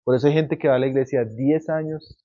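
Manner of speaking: usual speaking voice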